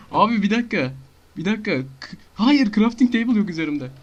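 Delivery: high, loud panicked yelling; frantic shout. Real, not acted. Gamer panicking mid-fight, shouting. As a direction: scramble in a panic.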